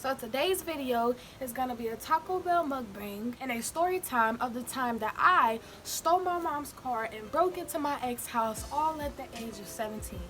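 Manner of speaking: ordinary delivery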